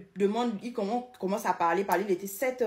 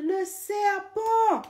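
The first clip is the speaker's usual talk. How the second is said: high, drawn-out panicked cry; mother shouting in alarm; 12 semitones higher, 4 dB louder, much slower than usual. Retold or imitated. Mother yelling from the living room that there is a snake. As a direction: call out the danger like a frightened mother. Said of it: "ma mère au salon qui crie".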